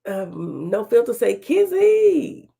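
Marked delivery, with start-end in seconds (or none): 1.4-2.5 high, loud drawn-out name; playful mock call. Playfully quoting viewer's call to Kizzy.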